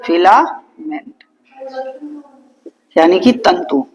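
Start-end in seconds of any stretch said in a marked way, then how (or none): 0.0-1.2 filament drawn out slowly; teacher stretching filament for notes; slow emphasis. Shown as a demonstration. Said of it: "क्या बोलते हैं?".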